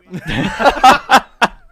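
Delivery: big loud high laugh. Real, not acted. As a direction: burst out laughing loudly. Laughter at his own joke.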